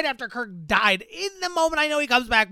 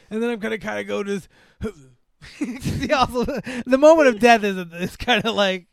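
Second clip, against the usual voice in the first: usual speaking voice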